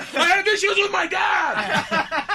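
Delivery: high, slow, loud mock shout. Shouting over the leaf blower during therapy, a loud mock shout. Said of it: "now I'm shouting".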